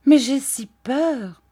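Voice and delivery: high, slow confession of fear; frightened sweetheart. A narrator's reading. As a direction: plead timidly, drawing it out. Dora confesses her fear.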